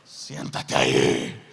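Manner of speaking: very low, slow command; stern elder. Acted. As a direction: drop the voice deep and order him down. Voicing stern church leader.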